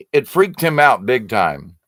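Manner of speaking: normal talking voice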